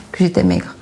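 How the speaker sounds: normal talking voice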